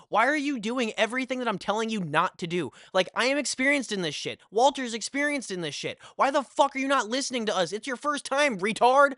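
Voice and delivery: high-pitched scolding that builds to an outburst; exasperated mad rant. Retold or imitated. Storyteller scolding the friend who ignored his warnings, mad and exasperated. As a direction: relive the anger, voice climbing in exasperation. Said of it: "It's getting me mad thinking about it".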